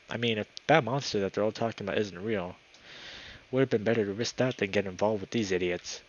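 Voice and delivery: ordinary delivery, nothing marked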